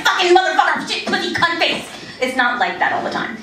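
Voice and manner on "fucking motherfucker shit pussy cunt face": high-pitched burst of cursing; mock Tourette's outburst. Comic mimicking the movie version of Tourette's, mock swearing outburst. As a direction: blurt out swearing like an exaggerated tic. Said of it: "people don't walk around just going like".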